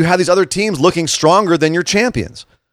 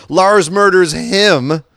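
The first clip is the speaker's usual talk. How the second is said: slow dramatic; 5 semitones higher, much slower than usual. Acted.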